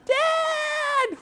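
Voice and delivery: very high, piercing daughter's cry; dad's impression of his daughter's high shout. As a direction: shriek it like a young daughter calling. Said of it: "It's all her".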